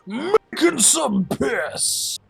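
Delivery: high, slow hammed-up announcement; mock announcer. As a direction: proclaim it like a grand herald. Mock announcement to stream chat.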